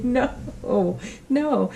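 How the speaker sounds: mock cringing no. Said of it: "even I cringed".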